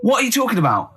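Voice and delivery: ordinary delivery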